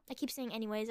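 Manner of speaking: normal talking voice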